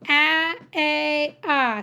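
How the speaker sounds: "A, A, ah" as short, long, then open vowel; three vowel sounds. Shown as a demonstration. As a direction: sound out "A, A, ah" separately and firmly. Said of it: "Say the three sounds".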